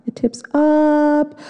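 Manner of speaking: slow, high "It tips up"; rising suspense. Acted. Suspense build on the rapid.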